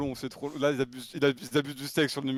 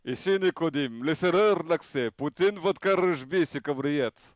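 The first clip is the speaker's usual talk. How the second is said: put-on Russian accent in French; slower than usual. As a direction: caricature Russian with rolled r. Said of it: "l'accent russe".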